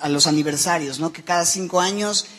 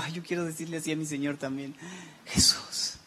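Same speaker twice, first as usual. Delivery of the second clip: much softer, almost breathed to the end; soft and tender; 9 dB quieter than usual. Shown as a demonstration. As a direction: hush down, tender toward the Lord. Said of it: "con una así como suavidad".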